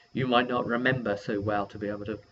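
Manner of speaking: usual speaking voice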